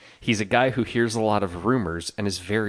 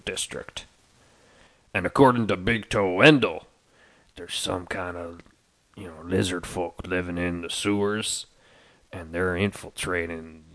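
gruff rumor-monger; 5 semitones lower, 5 dB quieter than usual. Acted. NPC Gorlosh rumors.

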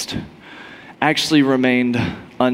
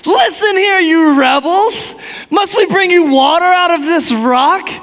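angry; 13 semitones higher, 5 dB louder than usual. Retold or imitated. Furious Moses scolds the crowd, loud and high. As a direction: bite out each word in fury. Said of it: "unless you're mad at 'em".

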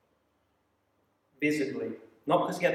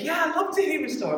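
high-pitched imitated enthusiasm; put-on high voice of an eager listener; eager passenger; 12 semitones higher than usual. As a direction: pipe up keenly in a much higher voice. Acted eager passenger answering him.

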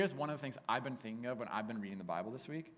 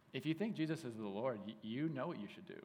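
usual speaking voice

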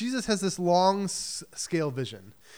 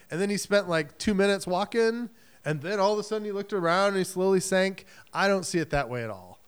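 ordinary talk

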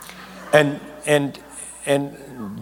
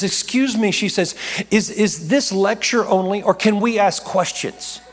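woman professor asking; 6 semitones higher than usual. Retold or imitated. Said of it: "And she says".

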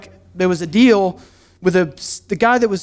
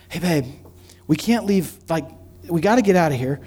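lower, softer conspiratorial aside; mock whisper to wife; 5 semitones lower, 6 dB quieter than usual. Husband leaning over to Beth, plotting a quiet escape. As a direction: hush it like a secret escape plan. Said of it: "I lean over to Beth and I'm like".

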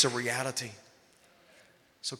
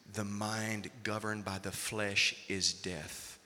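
slow reading; 6 semitones lower, 5 dB quieter, slower than usual. Acted. Reading scripture slowly.

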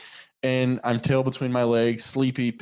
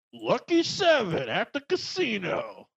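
cheesy friendly guard; 7 semitones higher, slower than usual. Retold or imitated. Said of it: "he just goes".

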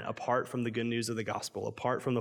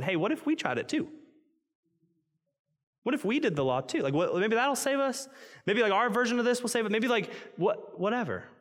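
much higher, silly hopeful voice; mock naive voice; 10 semitones higher than usual. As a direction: play the clueless believer for laughs. Mock naive self-salvation voice.

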